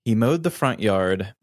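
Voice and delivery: ordinary delivery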